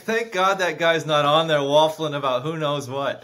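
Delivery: loud, high teasing fan voice; mock fans mocking.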